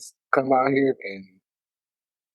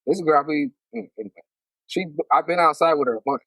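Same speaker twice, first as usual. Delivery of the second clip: ordinary talk, nothing marked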